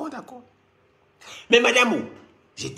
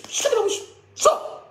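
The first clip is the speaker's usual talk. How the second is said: high mock shout; 13 semitones higher than usual. Shouting at the accent.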